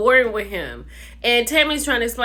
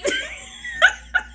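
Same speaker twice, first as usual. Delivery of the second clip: very high squealing laugh; high, helpless giggle. Real, not acted. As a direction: squeal with laughter at your own joke. Cracks up at corn star joke.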